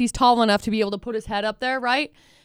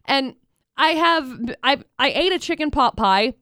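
ordinary talk, nothing marked

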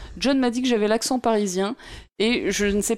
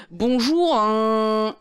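« bonjour » suivi d'un « hein » étiré; hésite longuement juste après « bonjour »; hésitation rejouée; much slower than usual. Retold or imitated. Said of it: "c'est juste que j'ai hésité". (« bonjour » en suspens, finale traînée)

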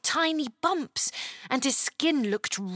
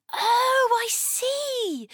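high, drawn-out "oh"; high little girl realisation; dawning understanding; 11 semitones higher, much slower than usual. A narrator's reading. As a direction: realise it suddenly, pitched way up. Chloe starts to understand.

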